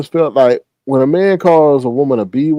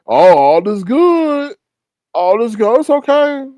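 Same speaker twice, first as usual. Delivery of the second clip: mock carefree; 5 semitones higher, 3 dB louder, slower than usual. Mocking someone in denial.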